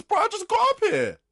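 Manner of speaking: very high, loud protest; indignant full-volume outburst; exasperated shout. Retold or imitated. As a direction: yell back in sheer disbelief. Shouted comeback to the shouting customer.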